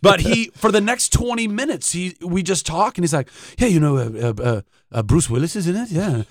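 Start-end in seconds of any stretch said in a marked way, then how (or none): 3.6-6.2 a hint of a posh British voice drifting toward a cartoon baby; lightly hammed elder actor rambling; bad Anthony Hopkins. Actor continues his admittedly bad Hopkins impression, lightly voiced. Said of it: "he's slowly becoming Stewie from Family Guy".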